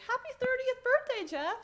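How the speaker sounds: high, drawn-out party greeting; imitating a crowd's birthday wishes; birthday cheer. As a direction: sing out a slow, high birthday wish. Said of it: "everyone was like".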